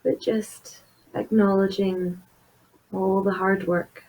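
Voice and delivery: soft, unhurried meditation voice with long pauses; guided meditation. Acted. Therapist leading listeners through a body scan, soft guided meditation. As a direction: gently guide the listener inward, leaving space between phrases.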